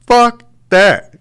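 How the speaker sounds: high, loud, exasperated exclamation; loud outburst. Real, not acted. Telling viewers they'd panic too, a loud high outburst. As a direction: blurt it out in disbelief.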